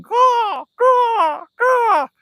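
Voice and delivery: very high, loud imitation crow; piercing mock bird calls; crow cawing. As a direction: caw like a crow overhead.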